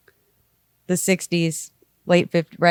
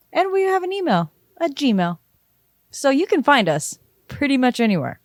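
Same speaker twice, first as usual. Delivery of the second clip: customer service voice; 3 semitones higher than usual. Acted. Co-host telling listeners where to find the show, customer service voice. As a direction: list it off like an automated phone line. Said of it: "This is my customer service voice".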